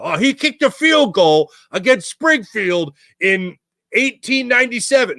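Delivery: slow, high, drawn-out retelling of local legend; imitating nostalgic townsfolk. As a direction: play a townsperson savoring an old tale. Imitating townsfolk recalling the famous kick.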